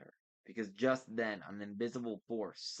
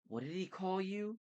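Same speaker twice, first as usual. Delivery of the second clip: higher-pitched muttered question; 10 semitones higher than usual. A narrator's reading. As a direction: ask it higher, as a puzzled mutter. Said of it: "Bianca muttered".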